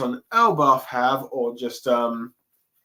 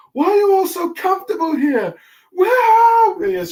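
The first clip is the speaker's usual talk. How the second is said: much higher, much louder panicked wail; crying Nami; 8 dB louder than usual. Retold or imitated. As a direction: shriek it out, near tears and lost. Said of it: "Nami's just crying".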